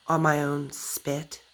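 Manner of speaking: ordinary talk, nothing marked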